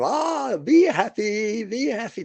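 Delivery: high, slow, sing-song be happy be happy; sweet Jekyll side. Retold or imitated. Cruise staff all smiles before turning nasty, high sing-song. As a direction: coo it like an overly sweet host. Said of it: "it's like Jekyll and Hyde".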